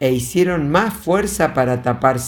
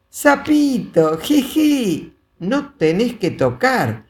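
put-on little girl voice; 7 semitones higher than usual. A narrator's reading. Small girl teasing her brother, high childish grumble. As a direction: giggle then whine like a small child. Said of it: "rezongó el niño".

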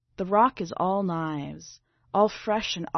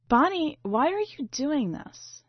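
upset protest; 7 semitones higher, 3 dB louder than usual. Acted.

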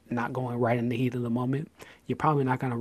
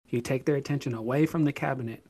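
normal talking voice

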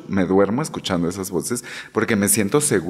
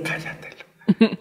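laughing hush; 13 semitones higher, 4 dB quieter, much slower than usual. Real, not acted. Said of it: "advice to listeners".

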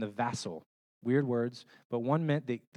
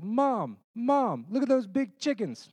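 put-on much higher, loud excited child calling her mom; little kid voice; 11 semitones higher, 5 dB louder, slower than usual. Four-year-old daughter calling her mom about buzzards, high excited child voice. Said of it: "my little four-year-old is like".

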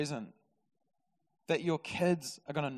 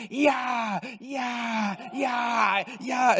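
excited cheering; 7 semitones higher, much slower than usual. Retold or imitated. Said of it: "It's like".

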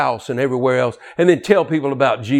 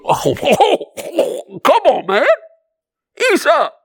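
mocking scoffer; 11 semitones higher, slower than usual. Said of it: "the people go".

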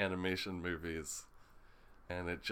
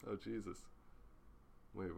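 ordinary talk, nothing marked

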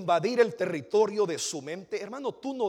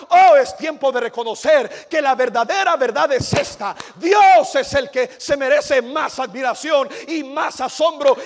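fiery preaching; 9 semitones higher, 16 dB louder than usual. Acted. Preacher calling the church to awe, building shout. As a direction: lift the congregation up to awe.

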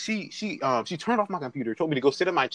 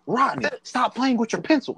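teacher scolding; 8 semitones higher than usual. Retold or imitated. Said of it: "She gets up".

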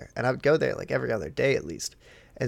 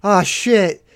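sudden realization; 4 semitones higher, 9 dB louder, slower than usual. Retold or imitated.

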